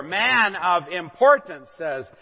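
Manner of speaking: ordinary talk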